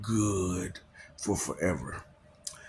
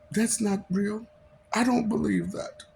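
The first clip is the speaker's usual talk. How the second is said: mock disbelief; 10 semitones higher than usual. Mocking doubters' dismissive reply.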